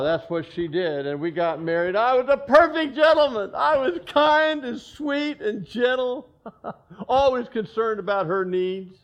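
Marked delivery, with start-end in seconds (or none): 1.9-9.0 mock sweet. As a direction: ham up the perfect gentleman. Mock self-praise as gentleman.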